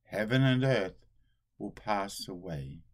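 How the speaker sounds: ordinary talk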